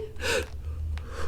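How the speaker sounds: breathless gasp; a sharp scared intake of breath; startled gasp. Acted. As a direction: Goose frightened by the ghost. Goose startled by ghost.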